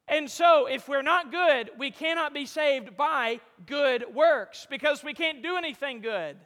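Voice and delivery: loud, building sermon cry; full-force preaching. Acted. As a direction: press the point home to the congregation.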